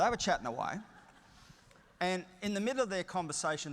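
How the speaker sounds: usual speaking voice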